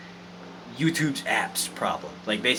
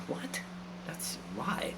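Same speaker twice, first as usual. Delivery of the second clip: whispered; 14 dB quieter, much slower than usual. Real, not acted. Baffled by chat comment.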